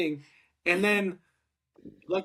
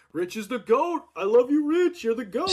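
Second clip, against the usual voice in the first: higher, rising fan-like cheer; gushing mock hype; 6 semitones higher than usual. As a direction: gush into the mic like a fan. Gushing on the mic onstage.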